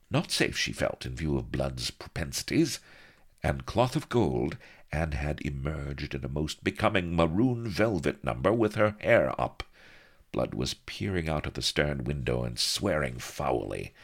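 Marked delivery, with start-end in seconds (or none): none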